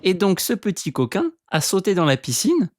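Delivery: high amused storytelling. Acted. Telling the dog's mischief.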